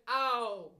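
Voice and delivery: loud, high yelp. Acted. Piglet coming down in Kanga's pocket, yelping. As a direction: yelp as you land with a bump. Piglet jolted down mid jump.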